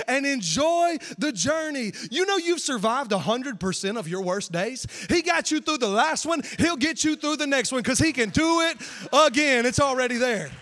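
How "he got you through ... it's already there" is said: loud, driving sermon cry; much louder, full-force preaching; fired-up preaching. Real, not acted. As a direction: lift the crowd with conviction. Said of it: "why is he yelling".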